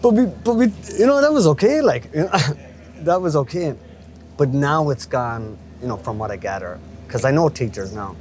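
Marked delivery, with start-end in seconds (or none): 0.0-3.8 words breaking into laughter; laughing. Real, not acted. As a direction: laugh through the words, higher and louder. Laughing about the flying duster.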